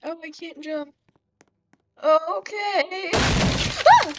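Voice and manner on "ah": high-pitched shriek of fright; startled scream. Real, not acted. Player hit by a jump scare, startled scream.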